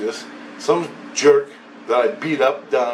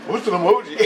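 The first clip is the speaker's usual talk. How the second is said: higher put-on baffled voice; puzzled aside; 7 semitones higher, faster than usual. The voice asking somebody nearby, mock bafflement. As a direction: mimic him asking someone, clueless.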